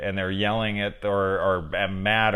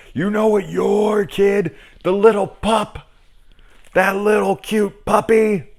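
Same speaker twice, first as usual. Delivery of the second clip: mock angry parent; 12 semitones higher, 7 dB louder than usual. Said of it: "mock parent blaming spouse".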